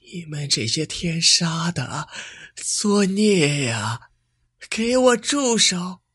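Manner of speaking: high, slow, straining shout; hoarse from yelling. A narrator's reading. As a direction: scream till the voice tears. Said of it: "她声嘶力竭地喊".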